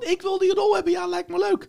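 high, mock-eager falsetto; mock naive actor taking the role. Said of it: "die zegt van".

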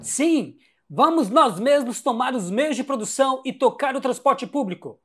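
high, loud fervent agreement; fervent cheering. Acted. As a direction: cheer the plan on. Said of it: "iríamos ouvir algo assim".

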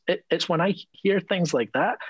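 ordinary talk, nothing marked

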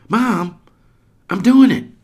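put-on high, loud stubborn boy; defiant kid shout. Hops cutting off his mother, loud and high. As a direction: insist at the top of your voice. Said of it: "he says".

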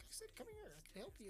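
very high tiny-troll voice; squeaky troll offering help; insistent little troll. Acted. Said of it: "you little fucking troll".

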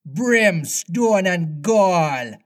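slow, high-pitched pirate oath; theatrical captain's curse, drawn out; thundering. A narrator's reading. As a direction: thunder it, stretching every word. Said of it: "thundered Hook".

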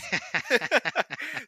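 loud high-pitched burst of laughter; squealing laugh. Real, not acted. Laughing at captain waving bananas.

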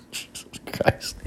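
slow, very soft whisper; whispered dismay. Real, not acted. Podcaster checking how long he has talked, whispered dismay. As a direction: whisper it under your breath, drawn out. Mutters on seeing the time.

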